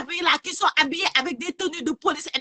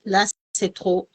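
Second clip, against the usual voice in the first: distant, far from the mic; talking away from the phone; phone held away; 7 semitones lower, 5 dB quieter than usual. Real, not acted. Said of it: "trop en colère".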